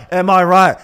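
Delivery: put-on high, loud drunken "am I right"; drunk joke plea. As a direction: beg the room for a laugh. Drunk king fishing for laughs.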